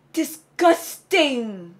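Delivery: exaggerated disgust. Shown as a demonstration. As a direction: draw it out in revulsion. Demonstrates word for disgust.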